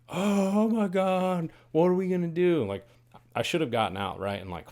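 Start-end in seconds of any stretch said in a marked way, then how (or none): 0.1-2.7 panicked cry. Retold or imitated. Too scared.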